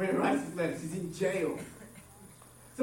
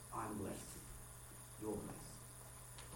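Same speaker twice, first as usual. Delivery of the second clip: hushed; 13 semitones lower, 20 dB quieter, much slower than usual. Retold or imitated. Paul in jail still says.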